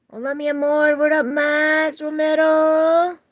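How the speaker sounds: exuberant greeting. Real, not acted. Streamer hails a viewer in chat, exuberant greeting. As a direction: holler it long and high.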